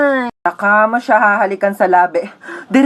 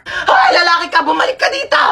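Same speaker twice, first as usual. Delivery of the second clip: yelling after him; 6 semitones higher than usual. Acted. Yelling after cheating man.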